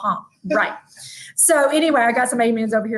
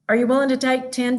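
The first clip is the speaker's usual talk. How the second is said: usual speaking voice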